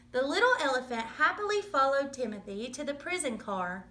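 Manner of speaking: ordinary delivery, nothing marked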